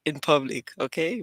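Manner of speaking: a hint of a British accent; mock British, half-hearted. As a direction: try it lightly in British. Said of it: "I said".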